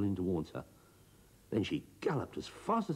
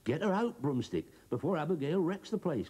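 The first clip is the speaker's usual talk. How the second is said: urgent cry; 10 semitones higher than usual. A narrator's reading. Farmer Giles begging Broomstick to remove the goose, urgent cry. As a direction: shout for help in a hurry. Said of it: "cried Farmer Giles".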